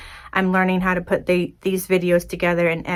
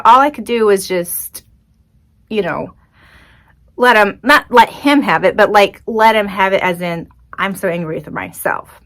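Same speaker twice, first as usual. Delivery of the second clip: usual speaking voice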